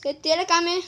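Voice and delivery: indignant accusation. Real, not acted. Player accusing his friend of breaking his things, indignant. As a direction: call him out, outraged.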